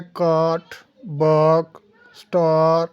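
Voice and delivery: काट, बक, स्टार with the aa held long. Shown as a demonstration. Teacher showing students the long vowel, drawn-out aa in काट, बक, स्टार. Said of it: "आ को थोड़ा सा लंबा पढ़ना".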